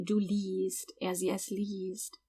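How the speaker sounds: liest said with a long, clear ee sound; teacher stretches the long ee in liest; long E demo. Shown as a demonstration. Teacher demonstrating liest for German learners, long ee. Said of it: "that I E in liest is pronounced like a long E".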